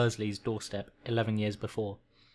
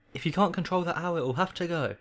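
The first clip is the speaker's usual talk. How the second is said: higher-pitched, mildly cross ultimatum; angry uncle; 7 semitones higher than usual. Acted. Uncle threatening over the owl, lightly acted anger. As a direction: lay down the law, pitched up.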